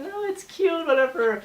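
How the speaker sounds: very high-pitched mock girl voice; mimicking girlfriend. Boyfriend retelling how she brushed off his hat protest, high mocking mimicry. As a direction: imitate her in a squeaky falsetto, half laughing.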